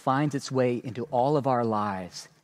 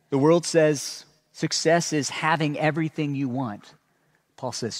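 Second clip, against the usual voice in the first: ordinary delivery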